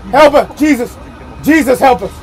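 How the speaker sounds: loud, scared calls for help; panicked prayer on the ride; frightened pleading. Real, not acted. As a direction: beg Jesus for help in fear. Praying in fear on ride.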